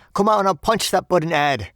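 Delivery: loud, high Cockney challenge; angry threat. A narrator's reading. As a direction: call him out for a fight. Bellboy retells his threat.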